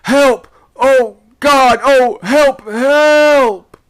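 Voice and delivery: very high, loud screams; broken, drawn-out wailing yells; desperate cries for help. A narrator's reading. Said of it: "broken, and, as it were, in gasps".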